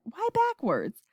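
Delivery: high, baffled question; crying out. A narrator's reading. President baffled by the radio report, crying out. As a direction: ask it in astonishment, pitch jumping up. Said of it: "cried the president".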